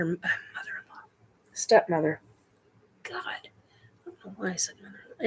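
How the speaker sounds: hushed aside, barely voiced, quick; whispered self-correction. Real, not acted. Correcting a slip about her stepmother, in a fast whisper. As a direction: mutter the correction to yourself under your breath.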